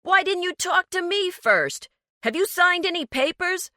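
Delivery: high, loud demanding shout; angry. Acted. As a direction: bark questions at her. Furious argument.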